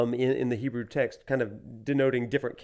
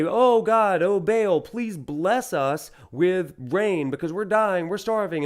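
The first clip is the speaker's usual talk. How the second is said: pleading prayer; 5 semitones higher, 4 dB louder, slower than usual. Retold or imitated. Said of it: "they would pray to this storm god and say".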